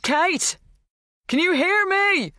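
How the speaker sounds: high, drawn-out call; shouting. A narrator's reading. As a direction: shout to reach someone inside. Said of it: "He wasn't used to shouting".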